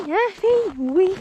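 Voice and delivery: high sing-song pet talk; talking to a dog. Real, not acted. Coaxing her dog, voice jumps high.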